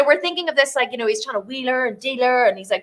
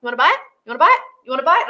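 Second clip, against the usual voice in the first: rapid-fire seller; much faster than usual. Retold or imitated. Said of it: "And I go".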